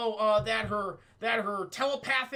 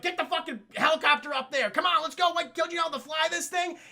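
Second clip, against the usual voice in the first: higher, pressing mock command; urgent retold shout; 8 semitones higher, 4 dB louder than usual. Man ordering the helicopter up, urgent mock shout. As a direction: bark it like a hurried escape order.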